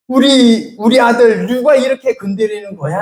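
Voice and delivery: angry protective God. Retold or imitated. God voiced as protective parent.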